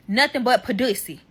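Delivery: ordinary talk, nothing marked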